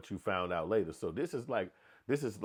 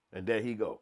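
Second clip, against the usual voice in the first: usual speaking voice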